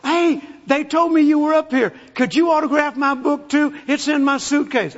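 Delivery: high, eager lady fan; second flight attendant. Retold or imitated. Another attendant wants her book signed too, high and eager. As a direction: imitate an eager attendant, pitched well up. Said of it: "another flight attendant come from the back".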